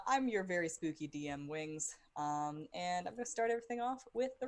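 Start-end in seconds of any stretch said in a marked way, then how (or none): none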